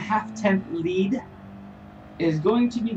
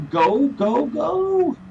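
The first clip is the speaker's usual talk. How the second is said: excited cheering; 8 semitones higher, 4 dB louder, much slower than usual. Real, not acted. Cheering a driver on live.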